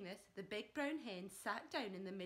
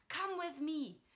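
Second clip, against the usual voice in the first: yelling; 7 semitones higher, 6 dB louder than usual. Acted. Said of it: "Mister Granky yelled".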